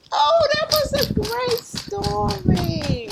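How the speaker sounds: high, drawn-out enthusiasm; delighted praise. Real, not acted. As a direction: gush slowly to little listeners. Praising the story just finished.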